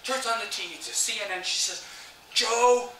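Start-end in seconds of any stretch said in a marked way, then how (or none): 2.3-2.9 high, drawn-out full shout; slow, stretched, alarmed yell; panicked scream. Retold or imitated. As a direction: scream the warning down the phone. Sister screams warning by phone.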